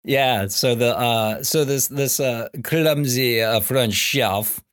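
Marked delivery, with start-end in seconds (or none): none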